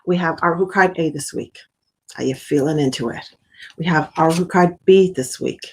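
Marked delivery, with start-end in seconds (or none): none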